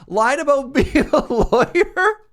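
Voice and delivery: high, loud words through laughter; drawn-out, laughing exclamation; laughing incredulously. Real, not acted. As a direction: crack up loudly while saying it. Cracking up over show's premise.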